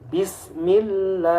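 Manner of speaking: BISMILLAH slowly with a long open 'a'; thin tarqiq. Shown as a demonstration. Teacher gives kasroh example, slow thin recitation. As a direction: stretch a light 'laa' ending on BISMILLAH. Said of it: "contoh dibaca tarqiq".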